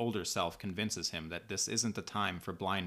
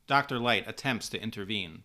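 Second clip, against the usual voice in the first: ordinary delivery, nothing marked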